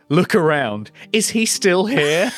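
high, cheeky sassy question. Acted. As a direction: toss it off with sass.

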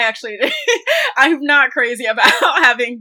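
laughing through the words; self-conscious laugh while talking. Real, not acted. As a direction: break into a laugh mid-sentence. Laughs at her own qualifier.

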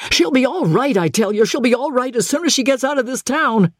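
high, rushed desperate insistence; desperate outburst; sudden desperation. A narrator's reading. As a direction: insist urgently, pitched high. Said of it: "turned on him in sudden desperation".

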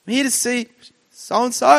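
high-pitched, anxious re-enactment; acted nervousness. Nervous visitor asking at the law firm's reception, high anxious voice. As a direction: play the jittery visitor, voice pushed high.

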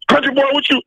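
high, sharply pitched coach impression; retold coach's retort in a high voice; imitating the coach. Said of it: "he looked at me".

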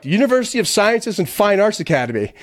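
high, cocky wise-guy reply; smartass answer. Retold or imitated. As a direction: play it like a smug smartass. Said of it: "trying to be a smartass".

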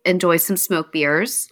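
ordinary talk, nothing marked

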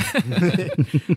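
bright burst of laughter; a real laugh at the joke. Real, not acted.

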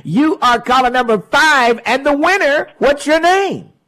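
high, loud DJ winner call; winner announcement. Real, not acted. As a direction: announce the winner with a big lift.

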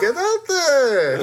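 high, very slow mock Israeli accent; hammy accent with a laugh in it; Robin Wright. Warrior woman sending Diana out, hammed-up Israeli accent. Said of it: "even Robin Wright is doing".

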